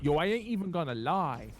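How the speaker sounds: a loose London Cockney impression; Cockney accent. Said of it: "Can't do a Cockney accent very well".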